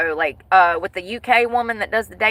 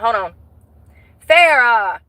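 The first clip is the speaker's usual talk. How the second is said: ordinary talk, nothing marked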